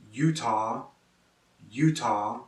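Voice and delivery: ordinary delivery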